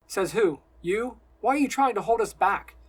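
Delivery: loud, defiant questioning; angry challenge. A narrator's reading. Simon challenges his friend's authority, angry and loud. Simon argues back angrily.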